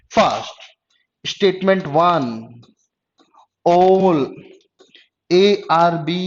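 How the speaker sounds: slow, spaced-out statements for copying down; slow dictation. Real, not acted. Teacher gives two statements for the next questions, slow dictation. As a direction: dictate item by item, pausing between each.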